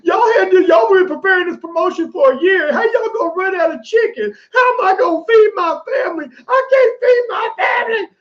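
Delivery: high-pitched, wailing mock outrage; distraught lady. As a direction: imitate a woman hollering in desperation over chicken. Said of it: "there's this lady who's saying".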